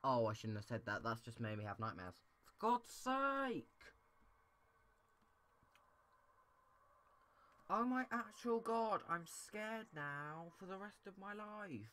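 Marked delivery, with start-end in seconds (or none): 2.4-11.9 much higher, louder, drawn-out shriek; frightened shriek. Real, not acted. Gamer scared by a noise, shrieking in fright. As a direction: stretch it into a terrified wail.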